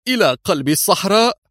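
high, nervous objection; a tense, edgy question. Acted. Woman balks at the plan, high and nervous. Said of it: "قالت في عصبية".

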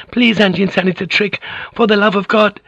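very high, desperate cry. Acted. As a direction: cry out, begging him. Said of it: "cried out".

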